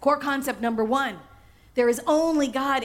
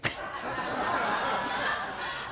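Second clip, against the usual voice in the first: soft breathy laugh; 5 dB quieter than usual. Real, not acted. Laughs at own joke.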